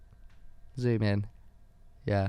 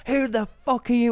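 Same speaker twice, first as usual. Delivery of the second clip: friend's retold fury; 11 semitones higher than usual. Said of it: "She was like".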